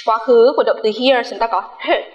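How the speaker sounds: ordinary talk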